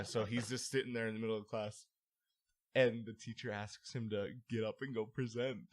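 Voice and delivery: ordinary talk, nothing marked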